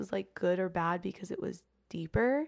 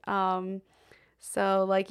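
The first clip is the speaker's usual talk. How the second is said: normal talking voice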